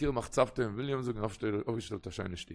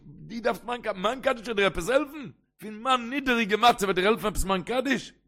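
high-pitched questioning of a father; raised-pitch, incredulous pleading; incredulous protest; 9 semitones higher, 5 dB louder than usual. Acted. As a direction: argue it upward, in disbelief. Son questions father in disbelief.

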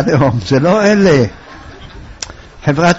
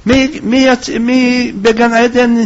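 high-pitched mourner's lament, stammering midway; acted despairing wail at a funeral; anguished wailing cries; 7 semitones higher than usual. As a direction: cry out as if bereft of your protector. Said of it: "זה צעק צעקות איומות".

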